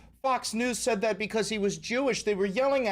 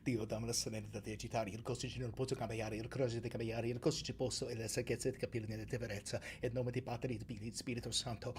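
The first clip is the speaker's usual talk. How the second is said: quiet, quick murmured Italian prayer; muttered prayer; 7 semitones lower, 11 dB quieter, faster than usual. Real, not acted. Streamer praying for patience in a soft Italian murmur. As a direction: pray under your breath. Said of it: "calling upon a God".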